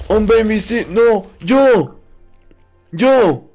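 normal talking voice

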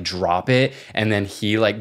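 ordinary talk, nothing marked

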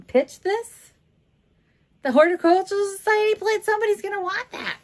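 high, loud exclamation; can't believe anyone would toss it; incredulous protest. Real, not acted. Indignant at idea of pitching plates.

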